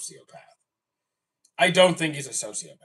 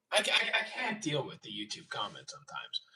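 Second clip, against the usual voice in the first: exasperated mutter; 2 semitones lower, 11 dB quieter than usual. Real, not acted.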